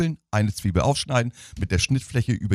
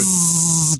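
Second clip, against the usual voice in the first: a quick buzzing zzz, higher pitched; imitated toothbrush buzz; 7 semitones higher, 3 dB louder than usual. Radio host shows hosts how he cleans seats with toothbrush, buzzing. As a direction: mimic the hum of an electric toothbrush.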